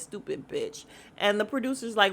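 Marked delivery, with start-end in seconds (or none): 0.0-0.8 low, soft mock mutter; under the breath. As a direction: drop it low as a sly aside. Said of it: "under her breath, says".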